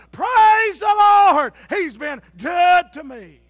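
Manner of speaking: high, loud, very slow shout; hollering praise. Retold or imitated. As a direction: holler from the porch to the morning. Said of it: "She'd holler".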